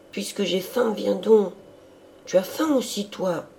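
high, lively pleading voice; eager hungry sister. A narrator's reading. Sister urging her priest brother to eat, high and eager. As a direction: coax him along in a high voice.

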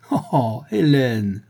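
a lightly voiced, gleeful exclamation; mild girlish delight at seeing someone; cheerful cry. A narrator's reading. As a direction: cry out gaily in happy surprise. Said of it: "cria-t-elle gaiement".

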